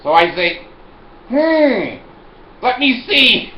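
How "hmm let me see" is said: very high, loud, exaggerated deliberation; mock pondering. Customer choosing between insulin and pills, high mock pondering. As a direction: make a big show of weighing it up.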